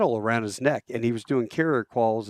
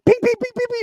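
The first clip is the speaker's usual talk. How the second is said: high, loud pinging noises; imitated bullet pings; 6 dB louder, faster than usual. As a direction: rattle off ricochet sound effects. Imitates bullets hitting helicopter.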